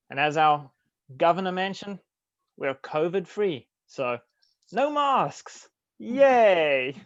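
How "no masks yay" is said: excited cheer. Acted. Cheering no masks.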